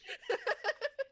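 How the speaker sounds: squeaky burst of laughter; high giggle. Real, not acted. GM laughs high after joke.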